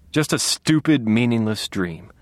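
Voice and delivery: usual speaking voice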